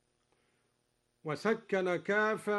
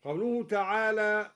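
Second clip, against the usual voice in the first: ordinary delivery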